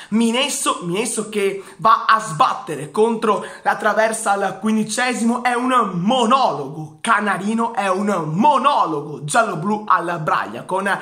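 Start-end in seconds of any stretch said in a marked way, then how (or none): none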